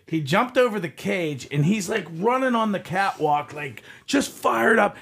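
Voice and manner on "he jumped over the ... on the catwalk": ordinary delivery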